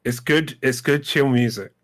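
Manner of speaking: talking with a toothbrush in the mouth; while brushing teeth. Real, not acted. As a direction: speak around a toothbrush. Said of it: "a brush in my mouth right now".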